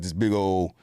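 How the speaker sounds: ordinary talk, nothing marked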